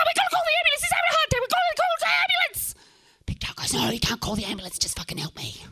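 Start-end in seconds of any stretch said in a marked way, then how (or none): 0.1-2.8 frantic high-pitched yelling; panicked scream. Acted. Pleading with Pigtail over a collapsed friend, panicked scream. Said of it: "and I scream". 3.7-5.6 curt refusal. Acted. Pigtail refusing to call for help, curt refusal. Friend refusing the screamed plea.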